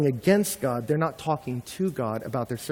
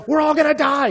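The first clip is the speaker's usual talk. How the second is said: higher, hammed-up cry of doom; mock panic; 13 semitones higher, 8 dB louder than usual. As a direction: wail it like a melodramatic crowd. Israelites panicking again, mock.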